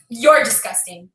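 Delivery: low, slow, deliberate put-down; neighbour's cold insult. Retold or imitated. Neighbour throws the insult back at her, low and slow. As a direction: deliver it low and drawn out, quoting her.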